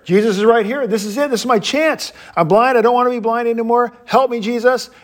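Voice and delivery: high, urgent and building; excited crying out. Acted. Blind beggar seizing his chance with Jesus, urgent cry. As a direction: plead as if the one chance is passing. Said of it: "it's this crescendo".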